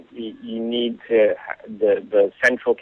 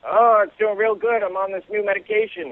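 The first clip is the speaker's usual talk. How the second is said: higher-voiced old man, proudly cheerful; forgetful old man bragging about his pills, higher old voice; 10 semitones higher than usual. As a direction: play a pleased old guy, pitched up. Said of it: "And the guy says".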